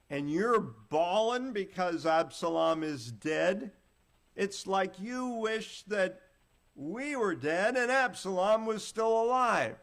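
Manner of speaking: building from firm to a loud, high, slow outburst; angry rebuke. Acted. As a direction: scold the king, rising to a furious peak. Joab confronts the grieving king.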